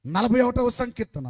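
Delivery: "నలభై ఒకటవ సంకీర్తన" called out loud. Shown as a demonstration. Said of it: "please". (గట్టిగా "నలభై ఒకటవ సంకీర్తన")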